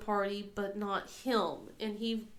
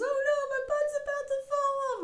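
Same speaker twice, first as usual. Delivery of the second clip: put-on high, far-off child whine; whiny kid; 5 dB louder than usual.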